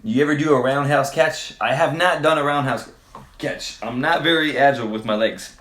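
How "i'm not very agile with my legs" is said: normal talking voice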